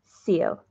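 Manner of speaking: "Seal" with a long ee; long-ee syllable. Shown as a demonstration. Stitcher recalling the first syllable of a name, long-ee syllable. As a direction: say "Seal" as in the animal. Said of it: "Is that what I said first?".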